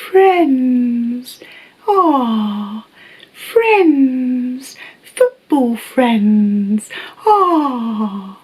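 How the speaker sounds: high, drawn-out, doting voice on friends; Inbetweeners catchphrase impression. Quoting a British sitcom's running joke to viewers, high and slow. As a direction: coo it like the show's running joke. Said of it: "one of the running jokes in it was".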